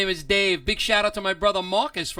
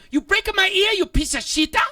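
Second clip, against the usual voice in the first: imitated yelling passenger; 10 semitones higher, 6 dB louder than usual. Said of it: "She goes".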